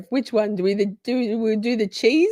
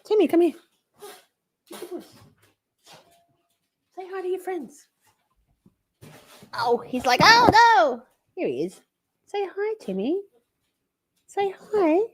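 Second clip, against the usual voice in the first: coaxing the cat; 7 semitones higher than usual. Real, not acted. Asking Timmy the cat to say hi, high coaxing. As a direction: coax the cat gently.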